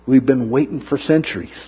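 ordinary delivery

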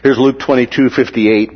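ordinary talk, nothing marked

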